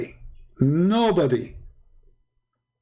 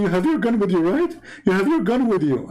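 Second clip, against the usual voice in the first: higher, anxious, pleading voice; suddenly desperate for his gun; scared plea; 6 semitones higher than usual. Retold or imitated. Scared hikers hearing wolves.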